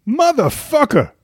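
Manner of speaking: high, slow tough-guy drawl; Samuel L. Jackson impression. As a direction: stretch it out like a badass. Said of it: "speed round".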